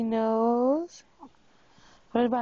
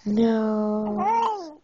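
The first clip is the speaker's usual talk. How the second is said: usual speaking voice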